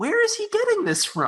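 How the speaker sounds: baffled. Retold or imitated. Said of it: "I'm like".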